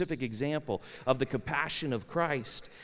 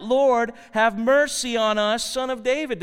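loud, raised plea from scripture; higher, louder narrated cry; 5 semitones higher, 6 dB louder than usual. A narrator's reading. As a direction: read it as blind men calling for mercy. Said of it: "they cried out".

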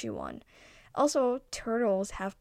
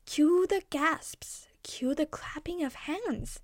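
whisper; 5 semitones higher, slower than usual. A narrator's reading. Narrator's aside about the crowd.

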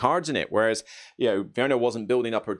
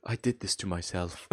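much softer, deflated mock despair; 5 semitones lower, 9 dB quieter than usual. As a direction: say it like you just doomed yourself, deflated. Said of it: "So it's like".

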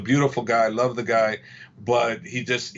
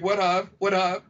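higher, put-on innocent what; playing dumb in a higher voice; mock innocent D C; 6 semitones higher than usual. D C feigning innocence at his coach, higher mock innocence. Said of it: "D C's looking at me like".

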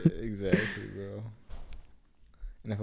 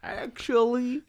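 a much higher, drawn-out know-it-all "Actually"; nerdy know-it-all; 13 semitones higher, much slower than usual. Retold or imitated. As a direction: play the smug smart kid correcting. Friend mimics the smart kid.

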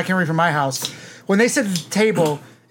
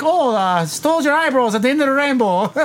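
put-on playful, higher Irish accent; 5 semitones higher than usual. Guessing the Irish mom's thoughts for the group, mock Irish accent. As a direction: put on a cartoonish Irish lilt. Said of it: "Irish accent".

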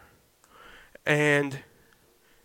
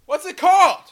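very high-pitched word-search outburst; exasperated high squeal; 12 semitones higher than usual. Real, not acted. Host groping for the word croissant, exasperated high squeal. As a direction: squeak it out while stuck for a word.

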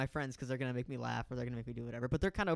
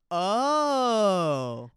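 loud, high, drawn-out OH; excited squeal; 5 semitones higher, 12 dB louder than usual. Retold or imitated. Friend hearing this is Ricky, excited drawn-out oh. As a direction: imitate an over-excited girl's long whoop. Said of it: "Like, excited, like".

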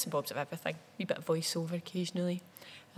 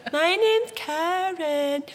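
high, slow silly schoolgirl voice; sing-song it like a giddy schoolgirl; 12 semitones higher, 10 dB louder, much slower than usual. Shown as a demonstration. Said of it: "silly schoolgirl voice".

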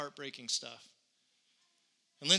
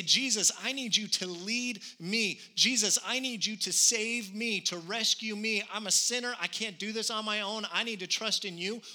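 fervent prayer; 4 semitones higher, 3 dB louder than usual. Acted. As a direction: plead with Jesus from the heart. Model prayer voiced in sermon.

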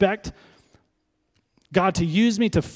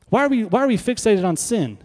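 ordinary talk

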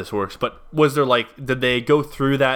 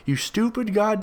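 retold yelling; 9 semitones higher, slower than usual. Rowdy fan behind cursing out a man, mock yell. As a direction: imitate a heckler yelling without real volume. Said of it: "started yelling in his ear saying".

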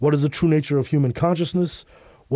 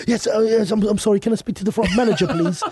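put-on higher, hurried polite British accent; 7 semitones higher than usual. Guest asking for the manager, pressing British accent. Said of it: "we use our British accents".

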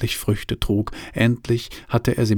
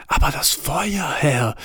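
excited whisper; 5 semitones higher than usual. Acted. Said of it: "flüsterte Heini Düvel aufgeregt".

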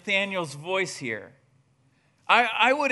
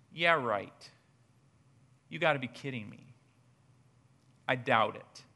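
sarcastic dismissal; 5 semitones lower, 4 dB quieter than usual. Retold or imitated. Friend scoffs at Philip.

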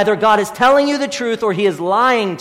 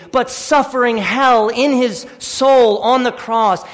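ordinary talk, nothing marked

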